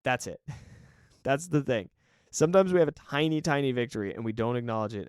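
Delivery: usual speaking voice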